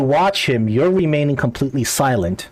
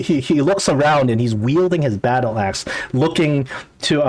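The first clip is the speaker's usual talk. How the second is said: ordinary talk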